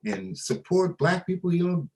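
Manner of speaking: lightly voiced accusing colleague; mimicking Tom Parker. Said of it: "And he said".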